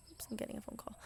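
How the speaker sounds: hushed, voiceless quick aside; whispered note to herself. Real, not acted. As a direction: drop to a whisper as the phone rings. Aside about an incoming call.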